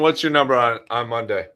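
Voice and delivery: normal talking voice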